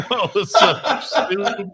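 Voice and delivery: high, slow, laughing whoa; teen laughing at a swearing doll; laughing mock awe. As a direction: laugh through the retold reaction.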